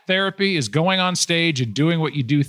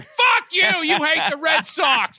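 mock Fenway fan shout; 12 semitones higher than usual. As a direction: bellow like a drunk Boston fan leaving Fenway. Red Sox fans jeering Fenway.

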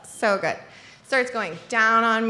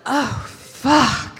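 drawn-out, moaning curse of pleasure; sexual pleasure. Shown as a demonstration. Showing how a lover should say it, a long pleasured moan. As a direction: savour it slowly, as if aroused. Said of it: "I wanna hear".

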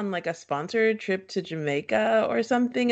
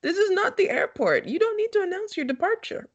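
mocking quote; 9 semitones higher than usual. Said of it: "they're like".

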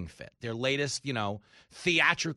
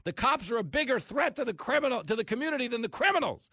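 much higher frantic mock voice; hysterical activists; 9 semitones higher than usual. Host mocking defund-the-police activists, shrill. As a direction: rant like a panicked activist. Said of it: "their latest screaming fit".

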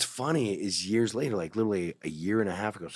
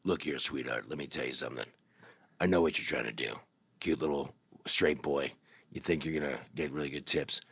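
low, gruff-sounding big bartender voice, softer; tough bartender; 7 semitones lower, 4 dB quieter than usual. Retold or imitated. Huge bartender warning off the straight boy, low and soft. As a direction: lower your voice into a huge leather bar bouncer. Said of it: "he's like".

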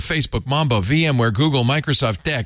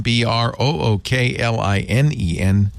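slow spelled out. Shown as a demonstration. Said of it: "spelling out the URL".

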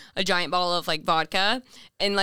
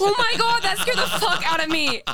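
high and louder, genuinely startled; startled high oh my god; 10 semitones higher, 3 dB louder than usual. Real, not acted. Jolted when a guest bursts in, startled high cry. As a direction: jump at the sudden scare.

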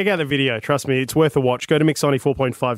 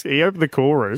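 normal talking voice

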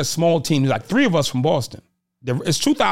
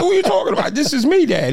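laughing disbelief; 9 semitones higher, 3 dB louder, faster than usual. Retold or imitated. Retelling reply to father, laughing.

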